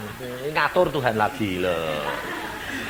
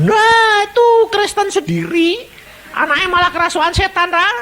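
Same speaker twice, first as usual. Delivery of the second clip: high, loud gloating jeer; mocking neighbour; 5 dB louder than usual.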